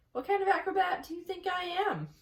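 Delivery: exasperated high retort; disgusted. A narrator's reading. Charlotte scolding the gander, high and disgusted. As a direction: scoff at the silly spelling. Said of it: "said Charlotte in disgust".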